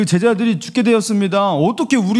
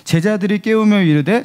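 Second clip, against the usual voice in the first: ordinary talk, nothing marked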